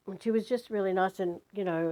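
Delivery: ordinary talk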